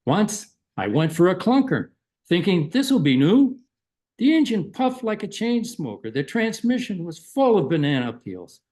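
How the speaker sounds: lively, lilting poetry recital with comic timing; humorous poem reading. Acted. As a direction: read a funny poem to an audience, pausing after each punchline. Reads humorous poem aloud.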